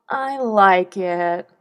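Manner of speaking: very slow bored line; a flat-toned bored reply, slow; unenthusiastic. Shown as a demonstration. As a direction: drag it out without interest. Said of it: "how unenthusiastically".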